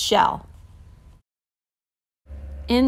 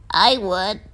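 creaky voice; 3 semitones lower than usual. A narrator's reading. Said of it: "creaked a crusty coral".

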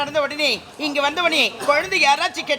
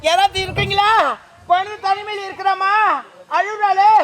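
calling out; 5 semitones higher than usual. Acted. Calling out in forest temple.

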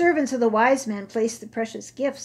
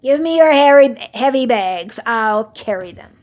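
loud, showy; bold offer; 12 dB louder than usual. Acted. Camel offering to millers, loud bravado. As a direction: boast while dreading it.

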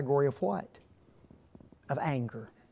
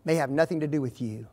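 ordinary talk, nothing marked

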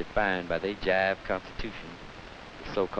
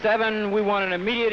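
loud high number call; counted out; 10 semitones higher, 7 dB louder than usual. Real, not acted. Activist calling out the platform point.

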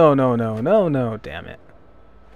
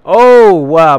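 loud, raised burst of surprise; startled exclamation; 5 semitones higher, 14 dB louder than usual. Real, not acted. Player caught out by the game, startled loud exclamation. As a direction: blurt it out, caught off guard. Sudden reaction to game mishap.

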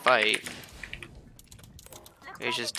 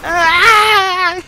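high furious scream; raging gamer scream; 18 dB louder than usual. Real, not acted. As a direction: scream out in gaming rage. Rage after dying.

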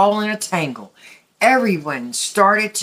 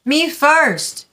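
higher, puffed-up insisting; proud demand; 9 semitones higher, 3 dB louder than usual. A narrator's reading. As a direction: demand to go first. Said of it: "all puffed with pride".

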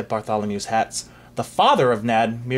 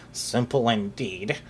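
slow, softer haughty mutter; haughty mumble; 3 semitones lower, 4 dB quieter, slower than usual. Acted. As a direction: sniff it out, unimpressed. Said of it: "mumbled Sir Snips haughtily".

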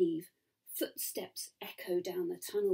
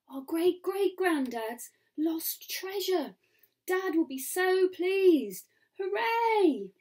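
high, slow marvelling rising to a loud cheer; delighted; 9 semitones higher, 4 dB louder, slower than usual. Acted. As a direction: savour the find, then cheer. Girl delighted at finding treasure.

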